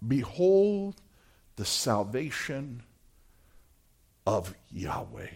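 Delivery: soft, slow, awed proclamation; quiet reverent declaration. Acted. Moses tells the people to behold salvation, hushed and awed. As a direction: let the words sink in reverently.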